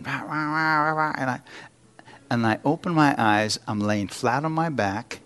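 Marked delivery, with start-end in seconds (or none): 0.0-1.1 wordless droning babble, pitched up; mimicked preaching. Man on his back hearing the preacher talk, mimicked babble. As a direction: imitate a preacher heard through a daze. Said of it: "He was preaching".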